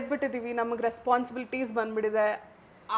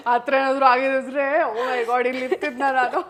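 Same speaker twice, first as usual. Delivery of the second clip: loud amused laugh, then words still laughing; 6 dB louder than usual. Real, not acted. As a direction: laugh at the wild idea. Laughs at question leak idea. (ಜೋರಾಗಿ ನಗುತ್ತಾ)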